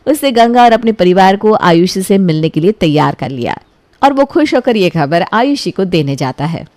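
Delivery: plain reading voice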